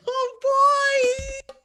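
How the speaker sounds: high loud hammy groan; mock groan. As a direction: play up the pain of a bad pun. Reacting to her own pun.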